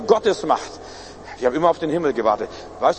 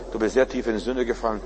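normal talking voice

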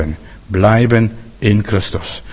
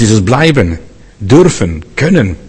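ordinary talk

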